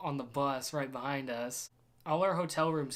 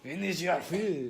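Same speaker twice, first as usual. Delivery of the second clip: playful mock Scottish accent; 3 semitones higher than usual. Teasing a friend's retold scolding, mock Scottish accent. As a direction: echo it in a put-on Scottish accent. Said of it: "Why is he Scottish?".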